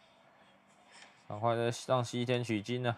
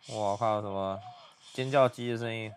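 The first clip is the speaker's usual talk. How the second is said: ordinary talk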